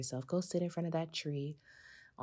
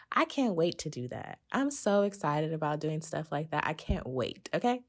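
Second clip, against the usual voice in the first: excited, louder and gushing; audible smile; 4 dB louder than usual. Real, not acted. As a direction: gush with real excitement, smile in the voice. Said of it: "I just hear the smile in my voice".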